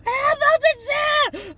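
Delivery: high, loud, slow sobbing wail; crying out in despair at the news; wailing ugly cry. Retold or imitated. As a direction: howl it through tears. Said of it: "doing the ugly cry and everything".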